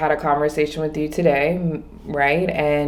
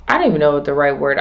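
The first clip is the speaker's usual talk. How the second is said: ordinary talk, nothing marked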